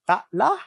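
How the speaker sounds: "tak lah" with "lah" rising high. Shown as a demonstration. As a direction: send "lah" upward after "tak". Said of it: "similar to the high tone".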